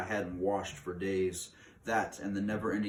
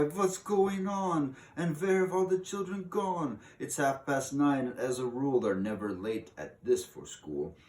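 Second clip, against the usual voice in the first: teachers crying; 7 semitones higher, 4 dB louder than usual. Acted. Teachers wonder where children went, high fretting voice. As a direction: fuss like a baffled teacher. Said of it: "The teachers cry".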